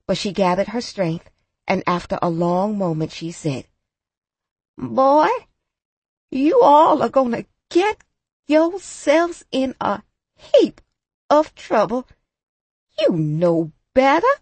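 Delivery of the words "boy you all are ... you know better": put-on old grandmother scolding, pitch climbing high; stern warning from a frail elder. A narrator's reading. Old woman scolds the boys.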